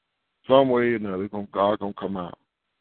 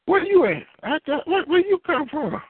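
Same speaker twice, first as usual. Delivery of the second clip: much higher, astonished squeal; surprised child. Retold or imitated. Children finding parents already at church, high surprised. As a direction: act stunned the parents got there first.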